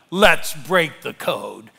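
loud, slow rallying cry; imitated shout. Daniel turns round to the youth group, shouting. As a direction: call it out loud, drawing out each word. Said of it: "He turned around and shouted".

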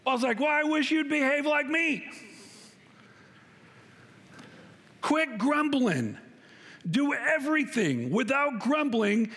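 high, emphatic sermon voice; fired-up preaching. Acted. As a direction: press the congregation hard. Preacher in high energetic mode.

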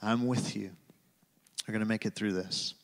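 God whispering comfort in someone's pain. Retold or imitated. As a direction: barely breathe the promise. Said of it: "And hear him whisper".

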